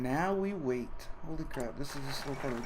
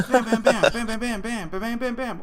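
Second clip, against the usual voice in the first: imitating message pings; 7 semitones higher, 8 dB louder than usual.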